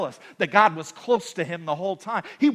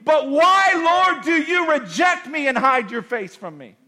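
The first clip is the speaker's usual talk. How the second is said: pleading shout; 9 semitones higher, 5 dB louder than usual. Retold or imitated. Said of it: "David's saying in Psalms".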